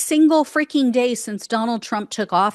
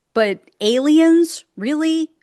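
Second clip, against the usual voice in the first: incredulous; 5 semitones higher, 5 dB louder than usual. Real, not acted. Mocking the word aliens.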